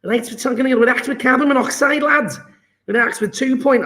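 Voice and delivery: Scouse accent. Shown as a demonstration. Chemistry tutor reading the question to the lads in an attempted Scouse accent. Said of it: "try and do a Scouse accent".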